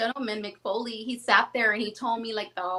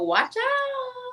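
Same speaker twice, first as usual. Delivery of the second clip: slow, much higher playful tease; drawn-out teasing warning; 13 semitones higher than usual. Real, not acted. Teasing fans about her singles, slow playful warning. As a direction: stretch it out like a hype line.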